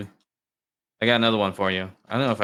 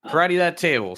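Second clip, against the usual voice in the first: commanding the dog; 7 semitones higher than usual. Acted. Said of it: "He says".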